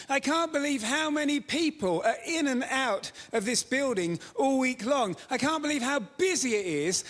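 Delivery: high, hammed-up gushing amazement; overexcited retelling. Pastor hamming up the administrator's amazement, gushing. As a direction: gush about the busy church. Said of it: "not have said these things with quite as much energy as I've just given it".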